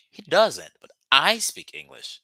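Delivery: he doesn't, but I do; contrastive stress on I. Shown as a demonstration. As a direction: make "I" the point. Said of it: "lay emphasis on something".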